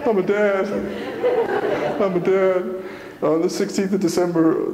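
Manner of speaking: pushing words through tears of joy; choked up. Real, not acted. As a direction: voice breaking high with emotion. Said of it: "When I get choked up, I can't talk".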